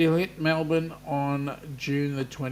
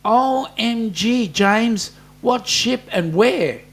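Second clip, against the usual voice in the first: excited; 7 semitones higher, 7 dB louder, slower than usual. Real, not acted. Excited at cruise news.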